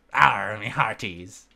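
slow, growled pirate Arr. Pretend. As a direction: ham up a cartoon pirate for a moment. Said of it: "I am a pirate".

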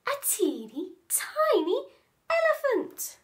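put-on high squeaky little boy; wide-eyed child, voice climbing high; little boy amazed; excited child.

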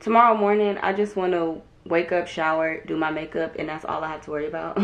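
usual speaking voice

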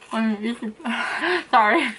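talking with food in mouth; speaking behind covered mouth; chewing while talking. Real, not acted. Seen 2: Eats purple piece at -2; covers mouth chewing until 0.9.

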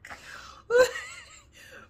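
high breathless laughing shriek. Real, not acted. Cracking up at the yelling woman in the clip, a shrieking laugh. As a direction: burst into a squealing laugh. Laughing after the clip's outburst.

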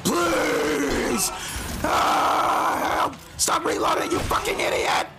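frantic high yelling for help; panicked screaming. Real, not acted. Panicked gamer under attack.

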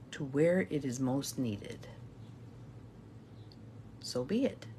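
reverent prayer. Acted. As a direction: intone each line for repeating. Leads a call-and-response prayer.

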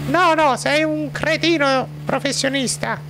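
high falsetto scolding wife; squeaky high woman, telling him off; Gustava voice. Acted. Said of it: "cambia voce la Gustava".

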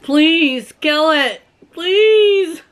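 high, drawn-out pleading wail; acted-out desperate high plea; mock screaming plea. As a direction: beg in a slow, rising mock panic. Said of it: "I started screaming".

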